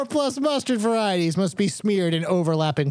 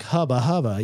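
leering hubba hubba; 6 semitones lower than usual. Shown as a demonstration. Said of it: "Anything can be sexual if you say it like this".

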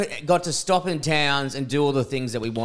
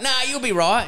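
high, breezy, offhand doctor voice, much higher pitched; careless doctor; 10 semitones higher than usual. Retold or imitated. Mocking the doctor who shrugged off the concussion, high and breezy.

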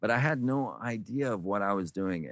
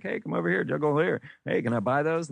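higher, bright mock call across the street; stranger calling out; 7 semitones higher than usual. Passerby calling the juggler over, high mock call. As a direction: hail him over, lightly imitated.

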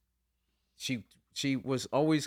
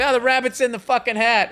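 high and loud mock disappointment; mocking deflated reveal; 11 semitones higher, 7 dB louder than usual. Husband mocking wife guessing gifts, high and loud. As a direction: spoil the trick sarcastically. Mocks spoiled magic trick.

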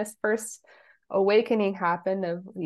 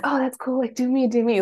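retold eagerness; 5 semitones higher, 4 dB louder than usual.

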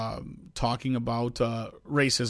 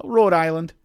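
hint of a Rhode Island accent, higher and louder; imitated Rhode Island accent; 4 semitones higher, 6 dB louder than usual. Stranger answers where he is from, imitated Rhode Island accent. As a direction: lightly mimic a Rhode Island local. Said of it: "And he goes".

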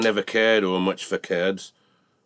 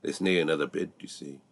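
hushed aside, much softer; whisper; 5 semitones lower, 8 dB quieter, faster than usual. Acted. Auctioneer whispering aside to Jim and the bull, hushed. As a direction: lean in and murmur it low. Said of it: "He whispered o'er to Jim".

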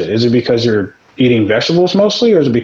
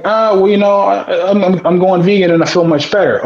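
higher, a mock self-satisfied dieter; mocking smug vegan; 7 semitones higher than usual. Host mocking vegans who feel better, mock smug voice. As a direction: parody someone bragging about going vegan.

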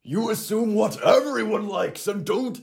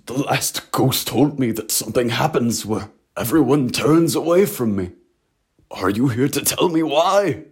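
usual speaking voice

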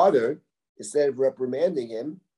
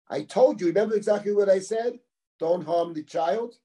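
insistent reproach; 6 semitones higher than usual. Acted. Acting out Reuven reproaching his brothers.

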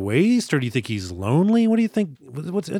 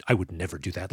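lower, softer, mock-grave denial; imitated deadly seriousness; 6 semitones lower, 6 dB quieter, faster than usual. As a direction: quote him low and dead serious. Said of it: "He's so deadly serious".

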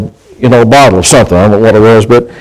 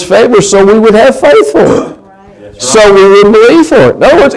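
higher, fervent preaching voice; 4 semitones higher than usual. Acted. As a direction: preach it out to the congregation. Preaches scripture at full force.

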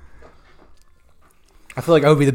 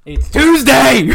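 shout; 10 semitones higher, 4 dB louder, much slower than usual. Pretend. Mock inmate yelling at fighters.

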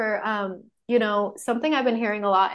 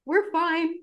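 higher, laughing mock reassurance; 8 semitones higher, slower than usual. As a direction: fake an okay while laughing. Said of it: "and just be like".

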